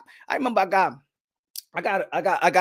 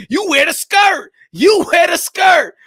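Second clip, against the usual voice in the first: loud, slow, higher taunting shout; shouted taunt at a rival; mocking shout; 7 semitones higher, 8 dB louder, slower than usual. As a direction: jeer at him loudly. Taunting Scottish boxer.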